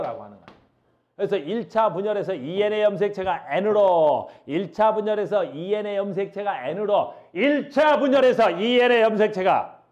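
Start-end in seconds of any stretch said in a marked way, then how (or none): 1.4-9.8 insistent classroom chant, rising in force; emphatic drilling. Shown as a demonstration. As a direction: hammer the phrase home, louder each time. Drills the key phrase by repetition.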